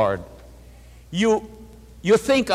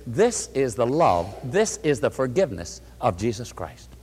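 tender; 6 semitones lower, 5 dB quieter than usual. Real, not acted. Preacher softens on God's love.

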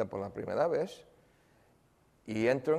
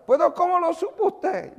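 very high, louder tearful plea; high tearful disbelief; crying woman's plea; 8 dB louder than usual. Retold or imitated. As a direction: voice the weeping woman begging to know. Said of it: "la mujer llorando".

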